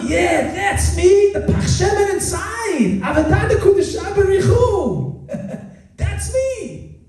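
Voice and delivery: high-pitched excited cry; elated exclamation. Acted. One who finds their pure inner self, exclaiming high. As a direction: cry it out high, as a joyful discovery.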